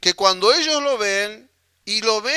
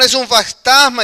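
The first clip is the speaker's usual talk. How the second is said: frightened cry; 4 semitones higher, 8 dB louder than usual. Retold or imitated. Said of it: "se turbaron y dijeron".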